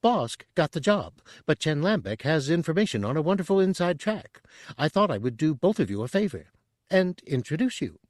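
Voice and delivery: high, fast eager chatter; high, quick Chadrafan. A narrator's reading. Tooty selling Chen to Bosk, high and quick. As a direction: rush the pitch like a nervous broker.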